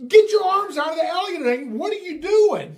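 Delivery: high, loud, fast parental shout; alarmed yell. Retold or imitated. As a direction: yell at the kids to get out now. Dad catches girls in tank.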